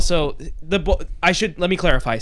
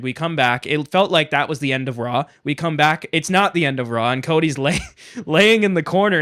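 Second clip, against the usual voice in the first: ordinary talk, nothing marked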